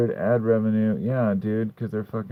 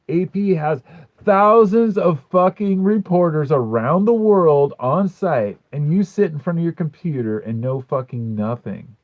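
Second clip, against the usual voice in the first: louder and higher, slow on thousands of reporters; angry rant; 6 semitones higher, 7 dB louder than usual. Real, not acted. Streamer yelling at a conspiracy commenter, furious. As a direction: blast it out, fed up.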